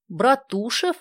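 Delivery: Братушев asked with a high rising question; can't believe the town Братушев; disbelieving. A narrator's reading. Dasha hears the town's name, asks in high disbelief. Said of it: "Даша не верила своим ушам".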